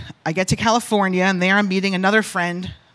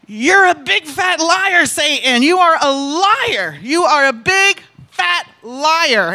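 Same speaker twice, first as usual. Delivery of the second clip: high, loud, drawn-out defiant rebuke; retold yelling; 5 semitones higher, 6 dB louder than usual. Telling off Satan alone in her room, retold defiant yelling. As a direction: hammer each word slowly at full voice, reenacting the fight. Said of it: "I'm yelling in my room".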